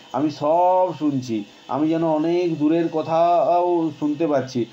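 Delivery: put-on slow, faraway sick child; dreamy slow boy. Sick boy to grandfather, slow and dreamy. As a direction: drift through it like half asleep.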